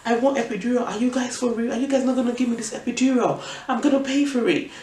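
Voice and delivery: high, insistent rant, scream held back; mock screaming demand. Patient demands pain relief from hospital staff, mock screaming. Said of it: "I just was screaming".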